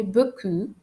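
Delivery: beaucoup said "bo-koo", no P; silent final P. Shown as a demonstration. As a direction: end beaucoup on the vowel, leaving off the P. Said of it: "I don't pronounce the letter P".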